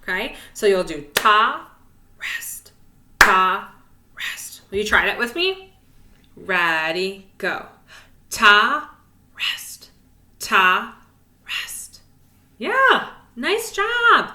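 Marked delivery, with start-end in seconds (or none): 2.2-4.6 ta spoken louder, rest whispered; leading the class, ta loud and rest whispered. Shown as a demonstration. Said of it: "So whisper those rests". 8.3-12.0 ta spoken louder, rest whispered; leading the class, ta loud and rest whispered. Shown as a demonstration. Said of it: "So whisper those rests". 12.6-14.3 excited praise. Real, not acted. Praising kids after exercise.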